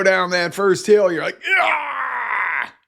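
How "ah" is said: very high mock scream; coaster scream. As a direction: let out a ride scream. Going down first hill.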